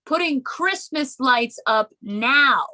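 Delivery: baffled high voice calling up to mom; incredulous daughter. Retold or imitated. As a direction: call up to mom, confused. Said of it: "I was like".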